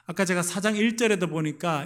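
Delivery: ordinary delivery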